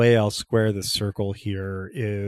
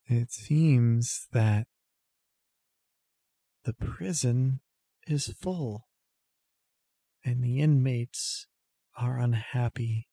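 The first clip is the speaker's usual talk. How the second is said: dreamy distant voice; 2 semitones higher than usual. Acted. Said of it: "speaks in a dreamy tone".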